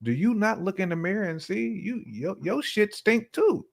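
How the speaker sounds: high, exasperated disbelief; incredulous. Real, not acted. Friend mocking people who judge, high and incredulous. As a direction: do you not see it, rising in disbelief. Exasperated rhetorical question to others.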